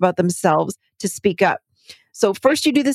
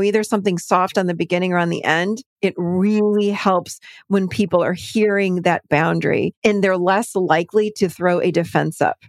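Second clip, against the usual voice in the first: ordinary delivery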